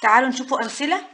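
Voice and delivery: ordinary talk, nothing marked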